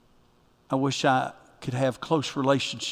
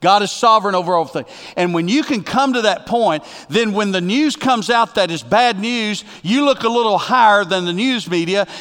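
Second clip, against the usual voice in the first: raised, loud pulpit preaching with high pitch; fiery preaching; 5 semitones higher, 7 dB louder than usual. Acted. Pastor urging the congregation to trust God, preaching loud and high. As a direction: preach it out with full conviction to the whole room.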